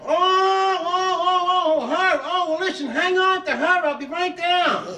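eager call from the upper floor; calling downstairs. Acted. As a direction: shout down the stairs, suddenly eager. Archie calls down from upstairs.

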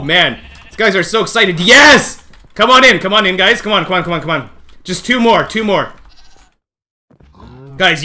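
ordinary delivery

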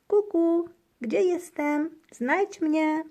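high, loud singsong hiding game; girl playing hide and seek; playful calling. A narrator's reading. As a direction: hoot to be found. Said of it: "pochukuje Misia".

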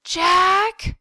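loud, high shout; mother's shriek. A narrator's reading. Mother calling to her son after a gunshot, shrieking. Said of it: "I heard my mother shriek".

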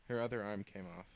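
ordinary delivery

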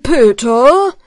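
loud stern father; stern call. Acted. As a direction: call the boy sharply. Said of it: "sagt der Vater".

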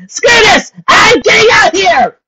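high-pitched panicked scream. Acted. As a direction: shriek it out in a panic. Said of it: "The teacup screamed".

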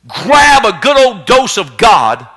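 slow, loud shouted command. Acted. Pastor rousing listeners to fight, shouting slowly. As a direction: hammer each word of the charge.

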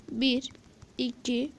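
ordinary talk, nothing marked

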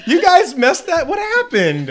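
laughing while asking; words through laughter. Real, not acted. Laughing at botched intro.

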